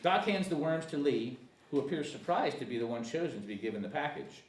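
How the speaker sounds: ordinary talk, nothing marked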